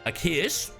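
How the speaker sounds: ordinary talk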